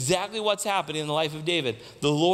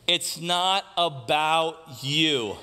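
very slow and measured, pitched down; slow reveal; 4 semitones lower, much slower than usual. Real, not acted. Preacher reveals the key point to the congregation, slow and low. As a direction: let each word land, taking your time.

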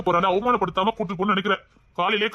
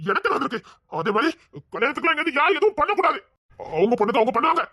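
heated warning with swooping pitch; angry ranting. Acted. Son bans any help with the wedding, angry. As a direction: rant on, cutting off all argument. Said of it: "அநியாயத்துக்கு கத்திட்டேடா".

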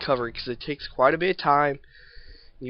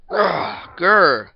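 frustrated growl; 6 semitones higher, 11 dB louder than usual. Real, not acted.

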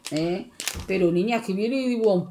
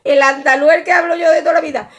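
playful boast; 6 semitones higher, 8 dB louder, faster than usual. Real, not acted. Boasting jokingly about her languages.

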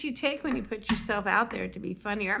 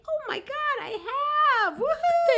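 shouting woohoo; slower than usual. Shown as a demonstration. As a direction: answer the heckle with a joyous whoop. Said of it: "if someone heckles you, just like".

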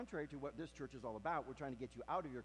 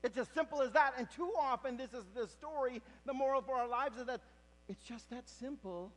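high, loud preaching build; full-force preaching; 11 semitones higher, 14 dB louder than usual. Acted. Preacher telling the congregation of Elijah's cave, loudly. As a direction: drive the story home to the congregation.

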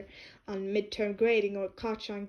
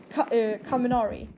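ordinary delivery, nothing marked

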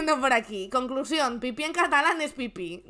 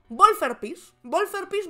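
emphatic demand; 7 semitones higher, 4 dB louder, slower than usual. Shown as a demonstration. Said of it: "me gusta".

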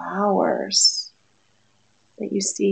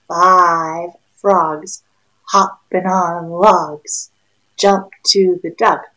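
ordinary delivery, nothing marked

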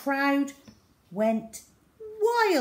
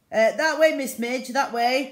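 louder, higher worried headmaster; concerned voice; 7 semitones higher, 10 dB louder than usual. A narrator's reading. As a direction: guide her off the stage with concern. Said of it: "in a concerned voice".

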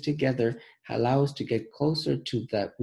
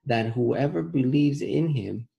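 slow, deliberate reading, word by word; slowly; 3 semitones higher, 3 dB louder, slower than usual. Shown as a demonstration. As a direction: read it slowly so the kids catch every word. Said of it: "Let's read it slowly".

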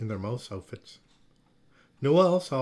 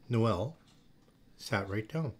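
usual speaking voice

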